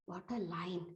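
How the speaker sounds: very soft, imitated audience murmur; quietly retold overheard reaction; hushed aside. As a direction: murmur it as if from the seat behind. Man behind reacts during contest.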